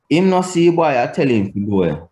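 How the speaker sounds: put-on HIM NO SEE BOY high, then GO AWAY dropping fast; statement intonation. Shown as a demonstration. Teacher showing "he did not see him", falling statement intonation. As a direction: start high, let I TELL HIM TO GO AWAY fall. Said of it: "he did not see him".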